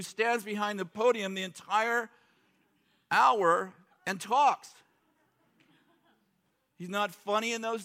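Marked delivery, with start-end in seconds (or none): 3.1-4.7 slow, stressed hour and talks; drawn out. Real, not acted. Pastor stressing the long sermon, slow drawn-out emphasis. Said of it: "speaker drawing out the words".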